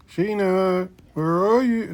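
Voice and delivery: high playful call to a lost record; calling out as if to a hiding friend. Pretend. Searching the record bin for [name], playful high call.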